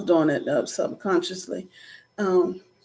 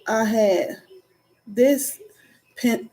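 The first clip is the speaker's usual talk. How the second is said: ordinary talk, nothing marked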